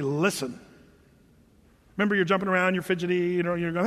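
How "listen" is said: lower, stern mom's listen; a hint of a stern mother. Mom sternly tells fidgety child to listen, low and firm. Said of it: "sternly say".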